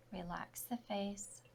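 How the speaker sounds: usual speaking voice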